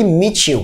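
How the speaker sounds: "Meet you" blended as mee-chu; t plus y as ch. Shown as a demonstration. Teacher models the linked meet you. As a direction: join the t and y of "Meet you" into a ch sound.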